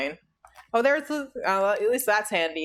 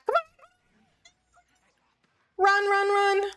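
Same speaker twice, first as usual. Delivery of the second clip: being chased; 12 semitones higher than usual. Real, not acted.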